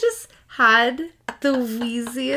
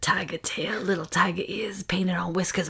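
whisper; 5 dB quieter than usual. Acted. Gina whispering about tiger costume.

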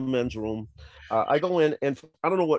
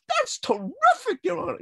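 mock delight. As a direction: overdo the thanks. Friend's sycophantic reply.

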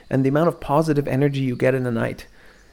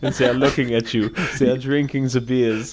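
put-on lightly German-accented description; German accent, playful; mock German accent. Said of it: "Hans and Lars and Johann".

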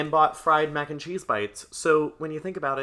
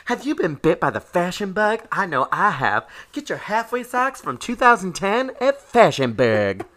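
raised-pitch, loud spoof announcer; mock ad read; 6 semitones higher, 5 dB louder than usual. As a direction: plug the store like a cheesy commercial. Joins in the mock ad.

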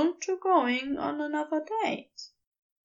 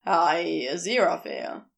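forced, lower Crowley; 7 semitones lower than usual. Acted. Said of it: "his smile did not reach his eyes".

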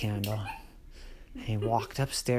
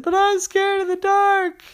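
put-on scared boy; 9 dB louder than usual. Terry tells his parents he's scared, high whimper. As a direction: whine your fear out. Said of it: "And Terry said".

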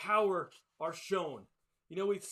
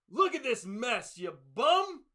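louder, higher mock scolding; grumpy parent scolding; 4 semitones higher, 8 dB louder, slower than usual. Grumpy parent scolding a child over the mess, mock angry. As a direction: bark it like a fed-up grumpy parent. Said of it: "like a grumpy parent".

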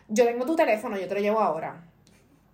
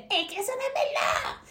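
imitated yelling; 13 semitones higher than usual. Guest disagreeing about bottled water, squeaky mock yell. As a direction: squeal a protest like someone arguing. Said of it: "me empiezas a gritar".

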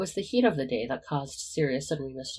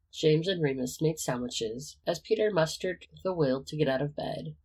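plain reading voice

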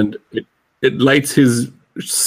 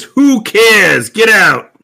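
louder, higher gruff brush-off; angry pirate; 7 semitones higher, 6 dB louder, much slower than usual. Acted. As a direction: bark at the intruder to leave. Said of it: "He says".

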